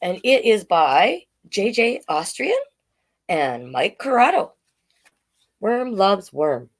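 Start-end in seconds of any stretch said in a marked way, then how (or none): none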